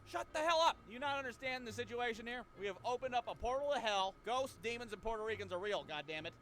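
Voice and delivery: mock panicked rant; exasperated yelling. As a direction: yell at Cody in panic. Scolding Cody about demons.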